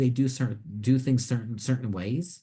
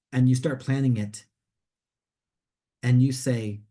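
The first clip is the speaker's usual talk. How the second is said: ordinary delivery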